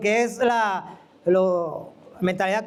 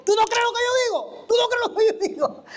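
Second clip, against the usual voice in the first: much higher, mock indignant prophet; 10 semitones higher, faster than usual. Prophet confronting the doubting speaker, mock high indignation. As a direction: ape the offended prophet's challenge. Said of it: "Y me dijo".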